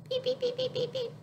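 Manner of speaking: very high squeaky beeps; playful beeps. Acted. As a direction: boop the nose with tiny high beeps. Booping baby's nose playfully.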